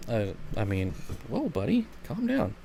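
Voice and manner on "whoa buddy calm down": soft high voice to the dog; pet talk. Real, not acted. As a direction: coo gently at the puppy. Talking to pet.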